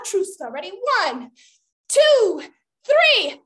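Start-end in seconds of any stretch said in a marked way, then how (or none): 1.9-3.4 high, loud, spaced count; countdown. Pretend. Leader counting children in for the spell, loud countdown. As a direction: call out the count with building anticipation.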